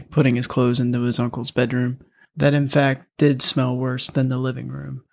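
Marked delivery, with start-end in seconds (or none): none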